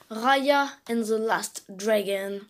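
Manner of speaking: slow careful try at an English accent on the title. Real, not acted. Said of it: "Je sais pas si mon accent est bien". (titre dit avec un accent anglais appliqué)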